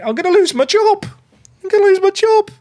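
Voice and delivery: high, strained panicked squeal; panicking. Real, not acted. Football manager fears the sack, panicking squeal. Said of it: "I'm panicking".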